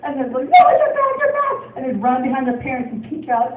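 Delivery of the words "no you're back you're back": put-on high, loud frightened child cry; mock panicked child; kids' panic. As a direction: squeal like a scared kid. Kids fleeing the witch.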